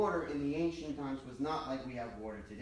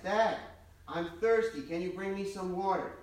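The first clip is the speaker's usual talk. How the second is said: put-on raised, whiny kid calling from bed; child calling out; 5 semitones higher, 6 dB louder than usual. Boy calls from bed to his father, pleading. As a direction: call out like a thirsty boy stalling bedtime.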